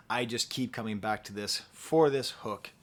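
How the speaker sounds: ordinary delivery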